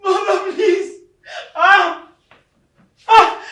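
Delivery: high wailed plea into gasping cries; anguished cry. Acted. Child crying out to dead mother, anguished. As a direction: cry out in grief.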